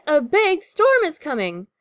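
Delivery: high, loud storm warning; urgent storybook lookout call; first mate's shout. A narrator's reading. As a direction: shout it across the deck. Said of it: "shouts First Mate Callie".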